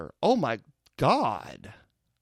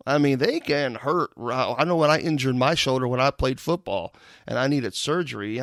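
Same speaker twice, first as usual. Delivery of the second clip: ordinary talk, nothing marked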